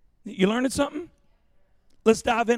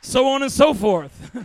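mock laid-back friend; 9 dB louder than usual. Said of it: "Mark's people talk".

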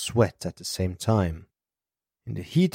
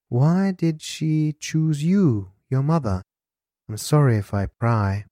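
Elisa's character voice, probing; 6 semitones higher than usual. Acted. Elisa asking Alex about his mother, high lilting probe.

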